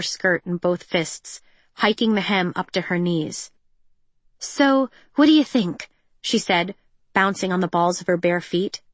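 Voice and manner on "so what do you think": excited. Acted. Peony twirls in new dress.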